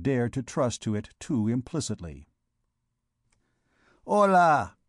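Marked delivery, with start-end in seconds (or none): 4.1-4.8 loud, higher call to a crowd; crying out. A narrator's reading. Clopin hailing the vagabonds from his cask, calling out. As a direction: holler for everyone's attention. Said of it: "cried Clopin".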